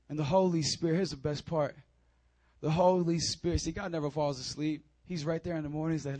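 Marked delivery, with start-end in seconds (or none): none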